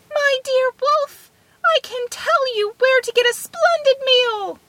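very high, tiny little-man voice; crying out. A narrator's reading. Tom Thumb calling from inside the wolf, high and coaxing. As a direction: coax the wolf in a piping voice. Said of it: "he cried out from inside the wolf".

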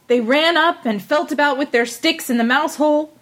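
ordinary delivery, nothing marked

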